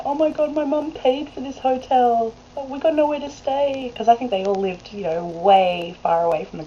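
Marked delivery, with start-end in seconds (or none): 0.0-3.9 imitating the kicked-out teenager whining about the hotel; whiny teen complaint. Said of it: "I heard one of them complaining".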